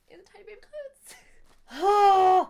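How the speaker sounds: high squeal ending in a loud burst; rising squeal. Real, not acted. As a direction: pitch climbs high then bursts loud on clothes. Overcome by tiny baby clothes.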